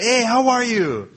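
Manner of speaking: high, loud chirpy hello; breezy greeting. Retold or imitated. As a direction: toss off a bright, careless greeting. Mock greeting in acted anecdote.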